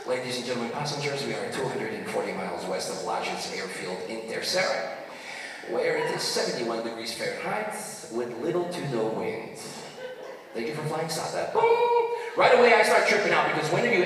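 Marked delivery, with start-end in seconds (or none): 0.0-11.5 lower, softer captain's announcement voice; pilot over the intercom. Retold or imitated. Comedian mimics the pilot making an announcement, low and soft. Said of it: "So our pilot comes back on". 11.5-12.1 mock intercom boom. Intercom click effect.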